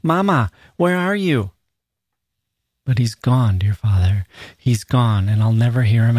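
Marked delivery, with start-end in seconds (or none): 0.0-1.5 put-on little boy calling. A narrator's reading. Grieving mother recalls her son calling from the backyard, high little-boy voice. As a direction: call out in a small boy's high voice. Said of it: "shout in his little voice".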